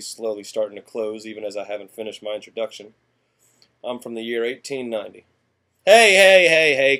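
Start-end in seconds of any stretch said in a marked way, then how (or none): 5.9-7.0 loud, high calls to stop them leaving; urgent hey, hey, hey; calling out. Acted. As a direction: shout after people walking away. Calling back leaving audience.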